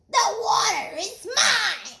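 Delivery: high, loud frog shout; high shouted claim; possessive yell. Acted. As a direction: claim the water loudly. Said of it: "yelled Milton".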